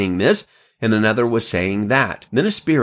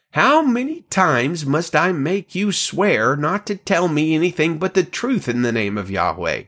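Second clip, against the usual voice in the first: exasperated demand; 6 semitones higher than usual. A narrator's reading. Exasperated king rebukes the prophet.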